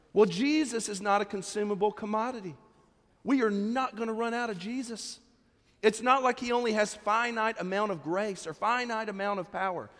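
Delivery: usual speaking voice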